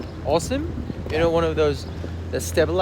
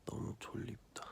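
sleepy whisper; 5 semitones lower, 18 dB quieter, faster than usual. Real, not acted. Tired early morning, whispering.

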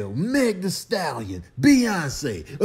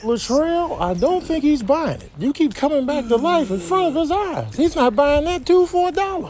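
voiced critter; 11 semitones higher, 9 dB louder than usual. Voiced animal.

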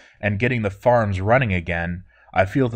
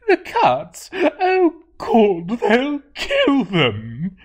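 very high, slow panicked wail; frantic cry; 14 semitones higher, 4 dB louder, much slower than usual. Acted. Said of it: "she cried and ran off".

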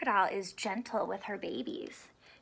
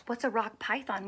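ordinary delivery, nothing marked

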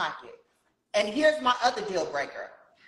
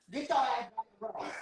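deep voice; 2 semitones higher, 6 dB quieter than usual. Pretend. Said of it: "You done changed the voice".

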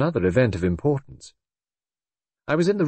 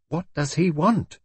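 high, slower, more insistent nervous question; nervous repeat; 9 semitones higher than usual. A narrator's reading. Brenda repeating herself as the inspector nears, nervously insistent. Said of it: "she repeated nervously".

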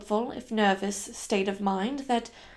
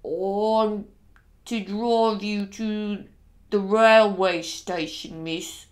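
slow, worried Dick; 2 semitones lower, 5 dB louder, much slower than usual. Acted. Driver reminding his young mistress of orders, slow and worried. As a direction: drag each word out, worried. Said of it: "In his slow, worried way".

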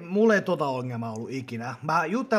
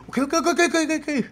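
squeaky high frightened okays; a nervous client quickly giving in; scared gym client; 11 semitones higher, 4 dB louder, faster than usual. Retold or imitated. Client gives in to a scary trainer with squeaky high okays.

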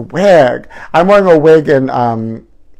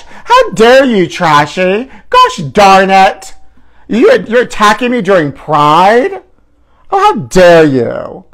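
mock outrage; 4 semitones higher, 3 dB louder, slower than usual. Mock outrage at insulting caller.

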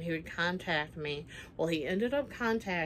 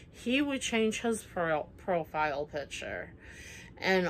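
ordinary talk

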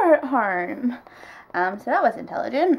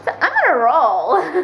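ordinary talk, nothing marked

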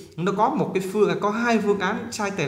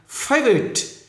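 "Favorite" with a very high first syllable; nhấn âm đầu; 8 semitones higher, much slower than usual. Shown as a demonstration. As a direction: hit FA in "Favorite" and drop away. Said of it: "nhấn âm đầu này". ("Favorite" nhấn cao âm đầu)

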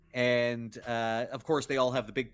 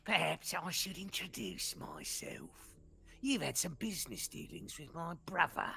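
high, softer goblin leader; smooth goblin leader; 6 semitones higher, 5 dB quieter than usual. Acted. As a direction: introduce yourself with oily charm. Goblin leader introduces himself.

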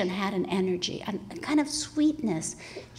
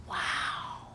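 whispered in slow, hushed wonder; awed whisper; 10 dB quieter, much slower than usual. Retold or imitated. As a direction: breathe it out in amazement, slowly. Inner awe at mother's energy.

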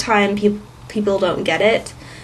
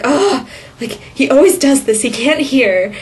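embarrassed mom; 4 semitones higher, 3 dB louder than usual. Retold or imitated. Embarrassed mom excusing husband.

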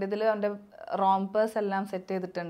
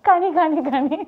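loud high calling out; 5 semitones higher, 8 dB louder, much slower than usual. Real, not acted. Calling out to baby.

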